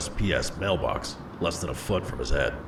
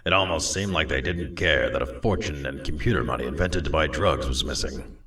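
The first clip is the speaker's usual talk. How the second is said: ordinary delivery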